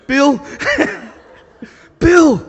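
loud, high laughing call of his name; preacher calling out to the old man on the track. Retold or imitated. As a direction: call out to Bill across the track.

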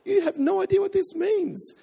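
very high, tearful mum impression; imitated sobbing. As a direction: wail it like an overcome mother. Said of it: "she's crying and she's sobbing".

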